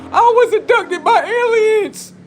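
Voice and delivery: high, laughing mock voice; mocking squeal. Mocking how someone would claim an abduction, in a high laughing squeal.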